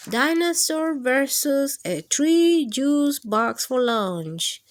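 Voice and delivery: usual speaking voice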